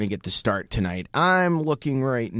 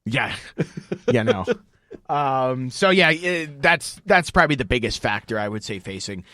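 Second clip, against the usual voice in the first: normal talking voice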